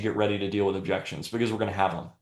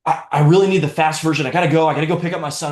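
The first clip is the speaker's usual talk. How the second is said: louder, higher, fast mock of a customer in a rush; hurried customer; 6 semitones higher, 6 dB louder, faster than usual. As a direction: rattle it off like a busy mum who has to leave. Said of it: "the customer's like".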